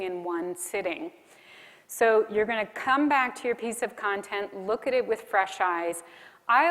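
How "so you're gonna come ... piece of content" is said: normal talking voice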